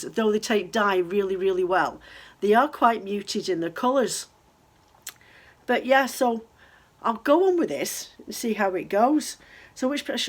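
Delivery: ordinary talk, nothing marked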